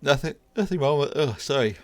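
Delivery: talking through a yawn; words stretched by a yawn. Real, not acted.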